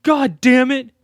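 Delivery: loud, high exasperated curse; frustrated curse. Retold or imitated. Locked in the room, he curses loud and high, exasperated. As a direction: blurt it out, fed up. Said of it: "he was just like".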